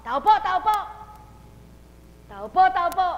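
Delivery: high, loud mock yell of a caller; shouting at a door. Believer shouting at a deaf neighbour's door, loud mock yell. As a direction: bang on the door with your voice. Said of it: "kahit na sumigaw ka ng sumigaw".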